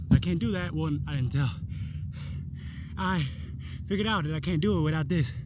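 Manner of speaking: ordinary delivery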